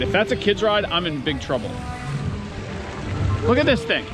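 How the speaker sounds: ordinary talk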